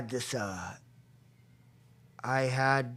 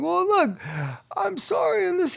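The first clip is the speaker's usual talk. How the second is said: much higher, defensive whine; whiny excuse. Retold or imitated. Justifying himself to his sponsor, high defensive whine. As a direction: plead your case.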